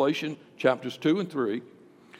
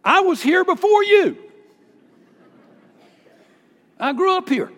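high, loud indignant claim; 14 semitones higher, 8 dB louder than usual. Retold or imitated. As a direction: insist you were here first. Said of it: "And then she added".

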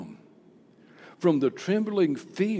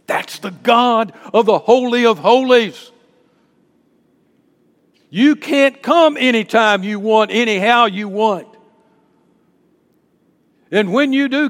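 loud, raised preacher's voice with weighty pauses; full-force preaching; 6 semitones higher, 13 dB louder than usual. Real, not acted. Preacher warning his church about approaching a holy God, full-force preaching. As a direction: thunder it out to the congregation.

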